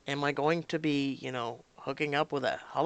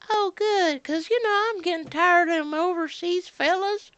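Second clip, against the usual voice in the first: much higher folksy voice; mock the relieved bigoted caller; 13 semitones higher, 4 dB louder than usual. Caller glad the agent is not overseas, high and folksy. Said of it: "people calling up".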